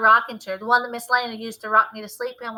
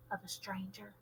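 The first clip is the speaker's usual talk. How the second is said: softer dread on the last word; hushed reveal; 2 semitones lower, 21 dB quieter than usual. A narrator's reading. Narrator sees a stranger's eyes, hushed. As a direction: let the word drop in dread.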